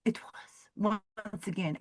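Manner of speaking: hushed whisper. Pretend. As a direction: breathe it in a whisper. Whispered aside.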